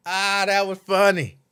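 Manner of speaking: usual speaking voice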